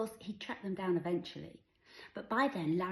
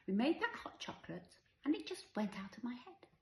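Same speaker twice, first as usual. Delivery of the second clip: put-on mum explaining to child; 7 semitones higher than usual. A narrator's reading. Mother explains to her child.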